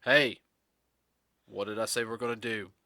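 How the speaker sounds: dropped, projected instructor voice after a loud "Hey"; stern teacher. Acted. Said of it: "right down to here".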